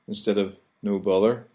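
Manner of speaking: usual speaking voice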